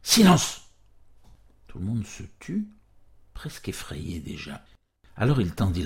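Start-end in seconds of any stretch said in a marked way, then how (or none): 0.0-0.7 high, loud shouted command; sudden alarmed bellow, pitched up; yelled. A narrator's reading. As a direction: yell for silence over the chatter.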